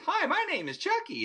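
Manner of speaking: high, sing-song doll voice; Chucky doll voice. Retold or imitated. Narrating the doll's greeting, in a high sing-song doll voice. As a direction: chirp it like a talking toy doll. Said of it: "the head turns".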